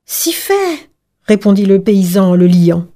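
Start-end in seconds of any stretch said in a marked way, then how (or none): 0.1-0.9 high-pitched eager peasant; eager reply. Acted. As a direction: answer readily, voice lifted high. Peasant answers the fox eagerly. (réponse empressée, voix haut perchée)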